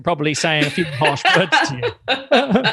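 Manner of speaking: playful Caribbean grandmother scolding, slow, laughing through; a laughing hint of a Caribbean gran telling you off; Caribbean accent. Granddaughter imitates her Caribbean grandmother's scolding, light Caribbean accent. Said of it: "in a Caribbean accent".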